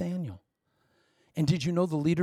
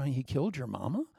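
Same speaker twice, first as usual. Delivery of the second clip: lower, softer incredulous query; skeptical questioning; 3 semitones lower, 8 dB quieter than usual. Acted. Listener checking the accuser's wild claim, low and skeptical. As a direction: question it quietly, in doubt.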